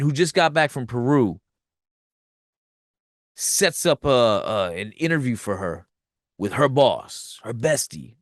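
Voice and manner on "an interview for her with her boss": ordinary talk, nothing marked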